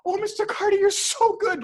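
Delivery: a client bowing in awe; gushing praise. Retold or imitated. Japanese client praising the photographer, high gushing voice.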